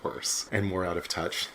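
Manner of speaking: normal talking voice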